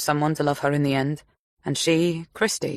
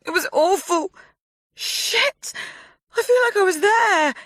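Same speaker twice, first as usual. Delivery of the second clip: high, louder distressed wail; near wail; 4 dB louder than usual. A narrator's reading. Clove wailing after hearing the murder. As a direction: cry out in horror. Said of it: "Clove practically wailed".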